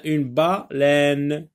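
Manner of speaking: "baleine" slowly split into ba... leine; slow "une baleine" down, breaking after ba; syllable by syllable. Shown as a demonstration. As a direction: draw out "baleine" in two beats, ba, then leine. Checking word for sound by syllables.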